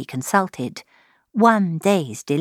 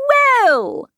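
high, loud exclamation; mother's cry; 14 semitones higher, 4 dB louder than usual. A narrator's reading. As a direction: burst out with an excited "well". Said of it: "cried her mother".

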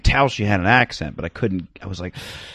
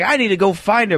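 eager thought; 9 semitones higher than usual. Retold or imitated. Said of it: "I was like".

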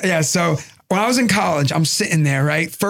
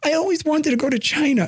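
high put-on voice, fake earnest; mock sincere voice; 8 semitones higher than usual. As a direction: pretend you always dreamed of it. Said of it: "I was like".

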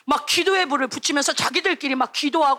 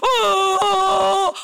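mock wail, long and drawn out; a preacher acting out a boy's loud lament; imitated wailing; 3 semitones higher, 4 dB louder, much slower than usual. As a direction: wail like a child weeping in prayer. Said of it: "막 땅을 치면서".

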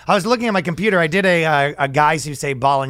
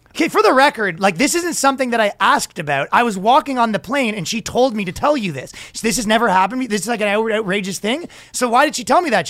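higher-pitched, face-saving protest; making a stink; 4 semitones higher than usual. Retold or imitated. Passenger saving face before watchers, making a stink. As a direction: retell an indignant case, pitched up.

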